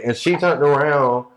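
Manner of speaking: usual speaking voice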